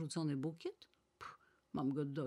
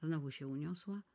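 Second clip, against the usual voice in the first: plain reading voice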